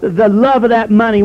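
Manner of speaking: normal talking voice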